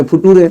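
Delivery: फुटूरे read as spelt, foo-too-ray; comic misreading फुटूरे. Shown as a demonstration. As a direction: sound out "future" letter by letter as फुटूरे. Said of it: "इसको पढ़िए फुटूरे". (फुटूरे, अक्षर दर अक्षर गलत उच्चारण)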